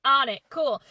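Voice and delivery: quick emphatic burst of readiness; taking charge. Retold or imitated. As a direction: act out a helper jumping straight in. Maid of honor takes charge, acted.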